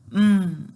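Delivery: loud pushed hum through closed lips; firm, forceful "mm"; lips-sealed hum. Shown as a demonstration. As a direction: project a word with lips sealed. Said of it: "have our lips sealed".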